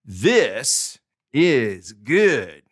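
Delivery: "This is good" drawn out very slowly, each word separate; slow example sentence. Shown as a demonstration. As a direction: read the example very slowly and clearly. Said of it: "look at this sentence right here".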